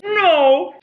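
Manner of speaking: very high squeaky no; pet voice. Real, not acted. Squeaky high talk to the cat.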